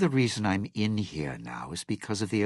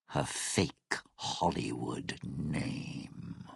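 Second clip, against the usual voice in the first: contemptuous whisper; 5 semitones lower, 7 dB quieter, slower than usual. Acted. Contemptuous aside.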